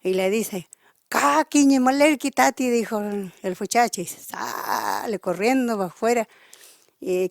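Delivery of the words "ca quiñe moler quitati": higher, louder scolding cry; old man yelling at the thief; angry yell. Retold or imitated. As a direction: chase him off with a furious shout. Old man chases intruder off.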